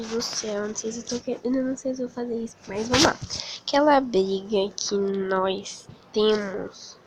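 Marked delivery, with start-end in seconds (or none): 3.6-7.0 slow dictation. Shown as a demonstration. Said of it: "dictating the message as she types".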